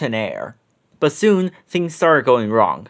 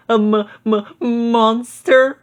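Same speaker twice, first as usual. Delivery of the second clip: slow, high, stammering scared voice; stuttering fright; 8 semitones higher, slower than usual. A narrator's reading. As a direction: stammer it out, terrified. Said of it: "Scooby gulped".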